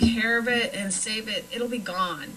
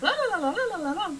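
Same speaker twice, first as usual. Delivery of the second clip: high singsong la-la babble mimicking fast talk; mock fast chatter; 10 semitones higher, much faster than usual. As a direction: rattle off la-las like someone talking too fast. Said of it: "And I was like".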